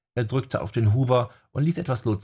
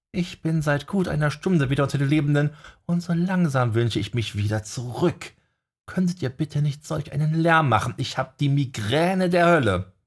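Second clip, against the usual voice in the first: mildly annoyed; 6 semitones higher than usual. A narrator's reading.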